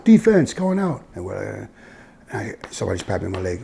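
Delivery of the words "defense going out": high, raised sideline call; coach calling out. Retold or imitated. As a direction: re-enact sending the defense onto the field. Coach calling his defense out.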